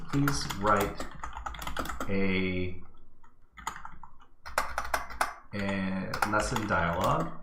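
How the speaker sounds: slow, halting, word by word; long gaps between words, as if typing each one; talking while typing. Real, not acted. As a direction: absently voice each word as you type. Saying the prompt while typing it.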